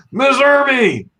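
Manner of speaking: cheerful high greeting to Miss Irby; excited greeting. Real, not acted. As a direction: call out her name warmly and high. Greeting a chat viewer by name.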